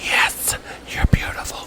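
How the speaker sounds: mock intimate whisper into the mic; imitated ASMR whisper. Host imitating the dude with two mics, whispering. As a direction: parody a whispering ASMR performer. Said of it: "the inaudible whispers".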